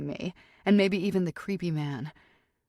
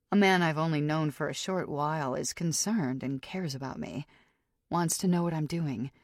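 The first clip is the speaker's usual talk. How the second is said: usual speaking voice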